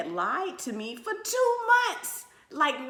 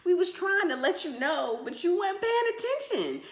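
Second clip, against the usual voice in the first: mock mother explaining; 10 semitones higher than usual. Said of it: "She was like".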